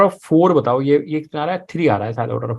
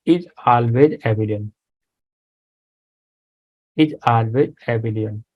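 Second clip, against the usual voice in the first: slow dictation; 2 semitones lower, much slower than usual. Shown as a demonstration. Dictating a line to students.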